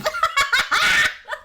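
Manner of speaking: loud, very high cackle; cracking up. Real, not acted. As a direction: burst out laughing helplessly. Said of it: "He's cracking me up".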